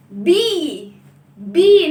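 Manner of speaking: بي said firmly, second one loud; bi sound demo. Shown as a demonstration. Teacher leading the class in the kasra sound, loud. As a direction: drop the voice down on بي and push it out. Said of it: "نعمل كده زي المعلمة".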